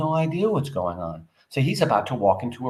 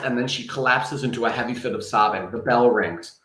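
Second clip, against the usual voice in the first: ordinary delivery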